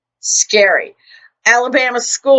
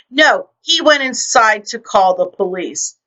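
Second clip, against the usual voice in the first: ordinary delivery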